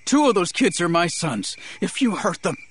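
high, thin, frightened anger; fear and anger. A narrator's reading. A father confronts his sons' captor, fearful and angry. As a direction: pitch it up, anxious and angry. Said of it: "thin with fear and anger".

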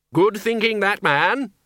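high, loudish commendation; hearty praise. A narrator's reading. Ridcully praises the Dean.

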